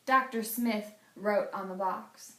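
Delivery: ordinary delivery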